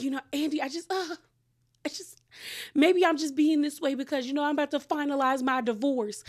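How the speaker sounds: high, hesitant mock-pitiful voice; pitiful excuse imitation. Host mimics castmate blaming her divorce, pitifully.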